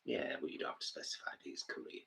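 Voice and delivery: very soft, almost whispered retort; hushed aside. Real, not acted. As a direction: brush off the correction under your breath. Muttered aside answering partner's correction.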